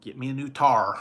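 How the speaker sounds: a mock Southern country accent. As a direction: say tire as tar, hammed-up Southern. Mock Southern tire as tar.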